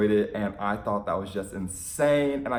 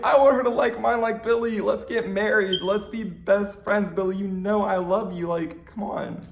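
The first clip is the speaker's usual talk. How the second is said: mock whiny fangirl; 9 semitones higher, 3 dB louder than usual. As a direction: ham up a jealous fan's begging. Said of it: "I was so sad".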